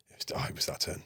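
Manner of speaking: whispering to himself, dismayed; a hushed "oh no" under the breath; breathy whisper of a missed turn; whispered realisation. Retold or imitated.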